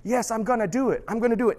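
a new convert eagerly promising to follow Jesus. Retold or imitated. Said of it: "and you're like".

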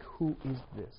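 a soft puzzled wonder at the wife in bed; bewildered hush. Retold or imitated. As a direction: murmur it like waking beside a stranger. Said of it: "you're like".

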